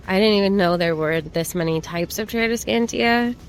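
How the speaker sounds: normal talking voice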